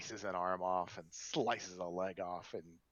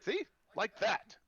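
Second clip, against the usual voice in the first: excited; 8 dB louder than usual. Acted. Innkeeper comments in character.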